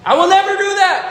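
high, loud vow. Retold or imitated. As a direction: swear it like a defiant teen. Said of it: "Anyone's ever done that?".

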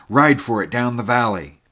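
urgent shout. A narrator's reading. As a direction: yell at them to ride away. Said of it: "the little man was shouting".

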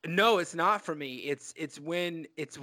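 ordinary talk

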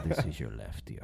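low, soft mock Khabib accent, ASMR style. Friend joining the pretend Khabib ASMR video, low soft accent. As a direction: murmur it close as a Khabib ASMR barber. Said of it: "Khabib A S M R video".

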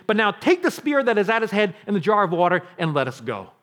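loud, ringing declaration; David's confident oath. Acted. David swearing the Lord will deal with Saul, loudly confident. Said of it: "the difference in David's voice here".

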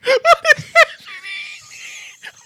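a high shrieking burst of laughter; screaming laugh. Real, not acted. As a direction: let out a shrieking cackle.

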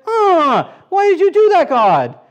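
high, loud, upset cry at God; a wailing, mock-upset protest; upset David. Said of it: "he gets all upset and real mad".

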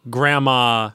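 normal talking voice

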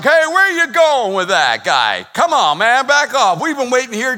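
loud, high-pitched protest; indignant crowd member. Acted. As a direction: call out at someone cutting the line. Crowd protests at men pushing through.

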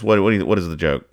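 ordinary talk, nothing marked

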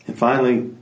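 ordinary delivery, nothing marked

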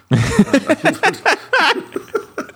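a real high burst of laughter. Real, not acted. Host cracking up at his own impression, real high laugh.

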